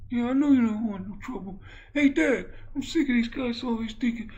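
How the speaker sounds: very high put-on boyish voice; high-pitched son character; squeaky son. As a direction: complain to dad in a squeak. Plays the boxer as squeaky son.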